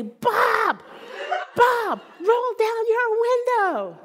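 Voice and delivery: high, slow, drawn-out mock holler; calling out. Driver to man in next car, calling out demandingly. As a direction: stretch each word to reach the next car.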